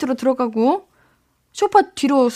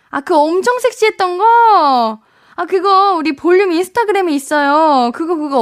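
excited; 5 semitones higher, 4 dB louder than usual. Real, not acted. Excited recognition of outfit.

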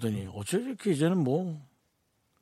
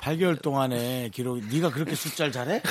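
ordinary talk

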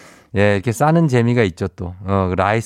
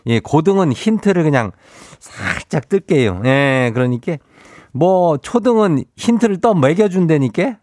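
Chungcheong dialect; 6 semitones higher than usual. Acted. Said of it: "행진리 이장인데요".

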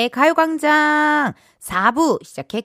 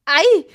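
higher, short cry of neck pain; pained yelp; 6 semitones higher, 3 dB louder, slower than usual. Shown as a demonstration. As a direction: yelp as the stiff neck catches. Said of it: "양쪽이 다 안 돌아가요".

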